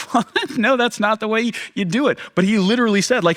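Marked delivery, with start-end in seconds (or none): 0.1-2.2 incredulous crowd. Retold or imitated. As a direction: react like a stunned crowd member. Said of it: "everybody's in the crowd going".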